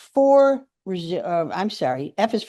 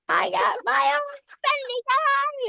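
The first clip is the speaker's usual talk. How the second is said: thrilled. Real, not acted. Thrilled about Christmas gift.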